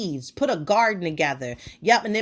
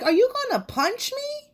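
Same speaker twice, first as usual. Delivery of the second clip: much higher, squeaky mock fear; mock alarmed. Facing the pointing clerk, mock alarmed. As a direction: play it wide-eyed and startled. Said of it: "I'm just standing there like".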